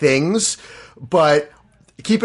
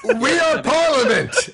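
mock grand proclamation; 12 semitones higher, slower than usual.

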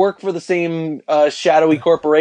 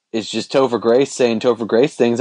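ordinary delivery

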